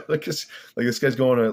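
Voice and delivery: cracking up, high and loud; laughing. Real, not acted. Describing a band all out of time, laughing. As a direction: burst out laughing and talk through it.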